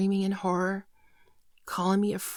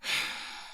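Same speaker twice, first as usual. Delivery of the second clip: heavy sigh; 6 dB quieter than usual. Acted. Confession about to begin.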